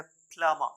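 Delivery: प्लम्बर said "PLUM-uh"; only M in the middle of प्लम्बर; silent B. Shown as a demonstration. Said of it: "बी का sound नहीं है". (प्लम्बर में बी चुप, "प्लमर")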